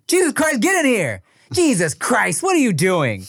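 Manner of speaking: put-on high, exasperated yell for the kid; exasperated parent yelling. Cosby's parents calling him in, exasperated yell. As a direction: call the kid in like a fed-up parent. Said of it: "his parents would always be like".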